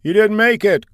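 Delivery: high, loud call from across the room; officer calling back from the crowd. Acted. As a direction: shout the bad news back. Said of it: "called out one of the officers".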